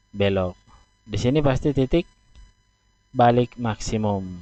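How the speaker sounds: normal talking voice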